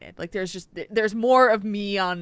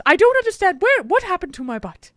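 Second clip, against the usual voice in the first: high, loud squeaky puppet voice; talking spider voice; 12 semitones higher, 9 dB louder than usual. Pretend. Parent voicing a hand puppet for a laughing child, high and squeaky. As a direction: squeal it like a panicked cartoon spider.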